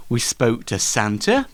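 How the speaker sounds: usual speaking voice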